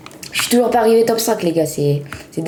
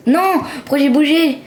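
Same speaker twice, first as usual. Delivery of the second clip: dismayed cry; 7 semitones higher than usual. Real, not acted. Moved by mistake in game.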